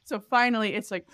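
ordinary talk

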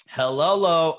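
loud, puzzled sounding-out of a greeting; weird hello. Retold or imitated. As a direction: read the odd greeting out loudly. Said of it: "you're saying hi in a weird way".

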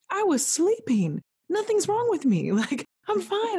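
high-pitched, drawn-out protest; exasperated insistence. Retold or imitated. Patient defends herself to worried staff, exasperated. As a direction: insist it with laughing exasperation, voice shooting up. Acted retort to medical staff.

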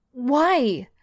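loud, high demand. Acted. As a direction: demand an answer. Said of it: "Shelby demands".

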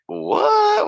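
very high, drawn-out mock surprise; feigned disbelief. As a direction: play dumb in a squeaky high pitch. Acting Lissa playing dumb.

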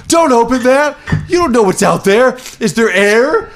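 high, urgent mock warning; quoting a panicked officer. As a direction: squeal it like a nervous crewman. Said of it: "and he's like".

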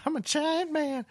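very high, slow squeaky giant; drawn-out squeaky cartoon voice; silly giant voice. Joking giant man voice.

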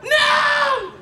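high shouted no; mock alarmed yell at the cop; panicked shout. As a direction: recoil and yell it out. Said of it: "And I was just like".

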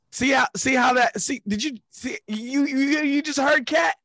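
high, loud excited pointing out. Real, not acted.

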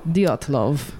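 put-on Dyatlov in a low Russian accent, Dee-AH-tuh-lov. Host tries a Russian accent on the name. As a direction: channel a Russian woman for Dyatlov. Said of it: "I'm gonna channel some Katya".

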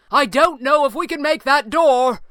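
high, loud urgent shout; crying out. A narrator's reading. Falcone warning companions about the exit, urgent cry. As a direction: shout it over the collapse. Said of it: "Falcone cried".

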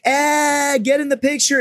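goofy call. Retold or imitated. Fired coworker calling everyone into a photo, high playful voice. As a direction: wave the guys in for a photo, playful.

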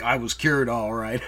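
low, understated sarcasm; sarcastic Alex. Acted. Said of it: "just sarcastically being like".

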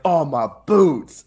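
drawn-out, loud cry of dismay; big exaggerated complaint, stretched out; mock dismay. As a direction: wail it slowly like a hammed-up grossed-out soldier. Acted soldier stepping in a body.

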